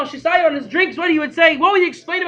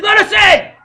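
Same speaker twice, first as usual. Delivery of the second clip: loud, high barked order from a brute at the door; shouted demand; 8 semitones higher, 8 dB louder than usual. Retold or imitated. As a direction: bang on the door and yell it. Cossack at the door.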